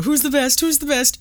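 high-pitched mock celebration; playful, cocky cheer up high; excited boasting. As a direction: imitate someone crowing over a win. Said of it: "They're so excited".

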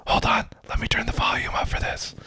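soft close whisper; whispered asmr role play. Pretend. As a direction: whisper as if a child might hear. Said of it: "we're just gonna whisper".

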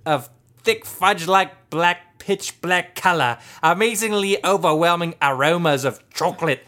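slow, loud British slipping into Australian; hammy British accent. Pretend. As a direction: proclaim it in overblown fake British. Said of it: "with a British accent".

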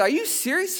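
retold incredulous reaction; incredulous question. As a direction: ask it high, in mock disbelief. Disbelief at wife's story.

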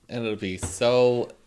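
usual speaking voice

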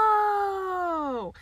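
long, high drawn-out oh; sudden realization. Real, not acted. Confused co-host finally recognizes the actress, astonished realization. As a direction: let the penny drop loudly, pitched high.

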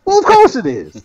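high-pitched little gnome; a squeaky, self-important gnome. Acted. GM answers as gnome.

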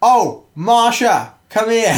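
high, loud, drawn-out mock summons; calling Marsha over. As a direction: call out to Marsha, hammed up. Playing someone calling Marsha over.

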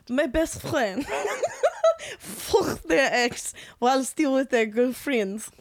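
slow, overdone French accent with a laugh; bad French accent. Shown as a demonstration. Friend reading the letter aloud in a mock French accent. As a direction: ham up a French accent, badly. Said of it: "that's not a good French accent".

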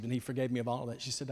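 normal talking voice

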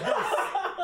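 high, loud laughing mock outburst; mock yell. Heroes yelling at Batman, laughing mock shout. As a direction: blurt it out through laughter.

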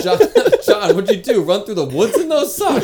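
high, shouted heckle with laughter; loud mock roast. Friend roasting John over his baby socks, loud mocking shout. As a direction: jeer at him, half laughing. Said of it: "Us roasting John's fucking baby socks".

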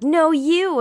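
loud, high mock comeback; childish retort. Mocking people who deflect blame, as a childish yell. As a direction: fire it back like a kid in a schoolyard. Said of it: "and they're like".

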